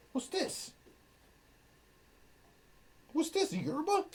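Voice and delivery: high, breathy pet voice. Real, not acted. Streamer cooing at her dog in baby talk. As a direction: coo at the puppy.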